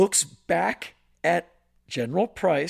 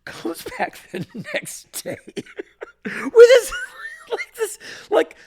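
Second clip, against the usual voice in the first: words breaking into real laughter; can't get through it without cracking up; laughing while talking; 7 dB louder, slower than usual. Real, not acted. Laughs while describing the bandage.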